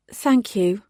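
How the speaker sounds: plain reading voice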